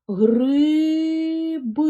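ГРИБИ drawn out very slowly on high, held vowels; slow syllable-by-syllable. Shown as a demonstration. Teacher shows first-graders how to count syllables, very slow. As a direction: stretch each syllable of ГРИБИ, high and sustained. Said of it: "Вимовляємо слово гриби повільно".

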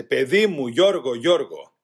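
loud, slowing call for his son; father shouting. A narrator's reading. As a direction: cry the name out, drawing it long. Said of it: "φώναζε".

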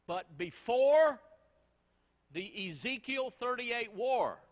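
BUT BEFORE drawn out, loud and high, then slow; slow emphatic build. Acted. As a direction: stretch it out to grab the room. Preacher slows for emphasis.

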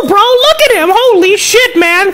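ordinary delivery

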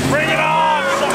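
loud, high taunt; shouting. Real, not acted. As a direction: call out the challenge. Challenging car at light.

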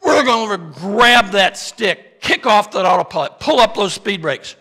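urgent commands. Acted. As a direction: bark out the recovery steps. Taking action in the emergency.

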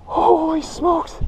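high, slow, drawn-out awe; winded amazement. Real, not acted. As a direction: marvel high while catching breath. Stunned, winded after the catch.

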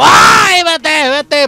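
very high, loud yell; frantic scream to get away; panicked shout. Real, not acted. Panicked under attack.

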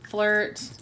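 normal talking voice

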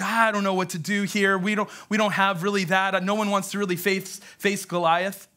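ordinary talk, nothing marked

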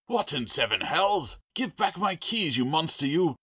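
high, loud outraged shouting; furious caretaker yelling; angry cursing. A narrator's reading. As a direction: explode at the thief. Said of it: "an explosion of cursing".

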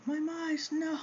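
high, loud mock wail of the other bird; imitating Kaito. Owner voicing the other bird losing its mice, high mock wail. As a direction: squeal the bird's protest in fun. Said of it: "You can hear Kaito going".

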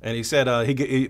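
normal talking voice

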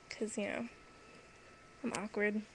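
ordinary delivery

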